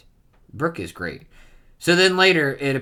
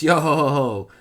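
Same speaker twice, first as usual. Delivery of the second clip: Brook's laugh; 5 semitones higher, 7 dB louder than usual. Acted. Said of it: "That's an odd laugh you've got there".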